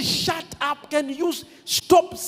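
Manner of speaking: mild mock rebuke from the crowd, the last word loud and high; the crowd telling the blind man to shut up, mildly hammed; annoyed crowd. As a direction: snap at him to stop, lightly acted. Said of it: "And they said".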